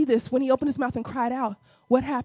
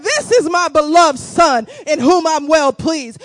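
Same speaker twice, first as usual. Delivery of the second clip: loud, declared heavenly words; booming scripture proclamation; proclaiming voice of God; 2 semitones higher, 9 dB louder than usual. Acted. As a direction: announce it boldly to the crowd. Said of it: "He said".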